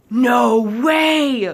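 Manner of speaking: high, very loud exclamation; excited shout. Acted. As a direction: shout it out in amazement, stretching the words. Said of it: "Lucas shouted".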